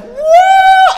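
loud, very high cheering whoop; imitated woman's whoop. Preacher acting out woman in South Sudan, loud high whoop. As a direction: let out a full, piercing "woo". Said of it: "she was just like".

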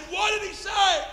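high, loud, slowed shout; urgent shouted demand. Retold or imitated. Preacher demands the translated answer, shouting. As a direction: cry out the question, stretching it.

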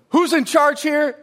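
high loud demand from an officer; cop's shout. Retold or imitated. Cop confronting the youth pastor, loud and demanding. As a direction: call it out like a cop arriving on the scene. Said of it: "that cop walked up to him and said".

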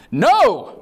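sharp high shouted no; Peter's loud no. Retold or imitated. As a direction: bark a refusal as Peter. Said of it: "said Peter".

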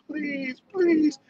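high, hammed-up pleading; mock beg on his knees; begging. Korean guy begging the examiner, high mock plea. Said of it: "he's like".

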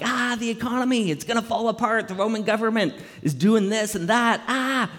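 high-pitched hammed-up panic; comic worrier in a flap; mock anxiety. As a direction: fret frantically, in a put-on high voice. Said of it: "full of stress and anxiety".

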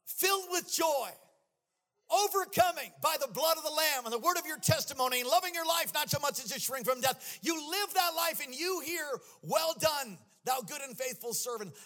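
loud, high, sing-song preaching; preaching at full force. Acted. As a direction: build it like a revival climax. Climactic preaching with music.

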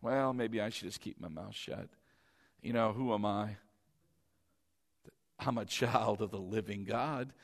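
low, soft, hesitant mumble of a timid believer; timid and shrinking. Retold or imitated. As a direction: shrink back into yourself as you say it. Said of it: "you shrink back".

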